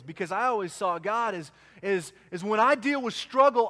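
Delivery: usual speaking voice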